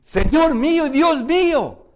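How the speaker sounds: high, loud cry of awe; exclamation of admiration. Shown as a demonstration. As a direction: proclaim it as an awed exclamation. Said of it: "Y está en exclamaciones".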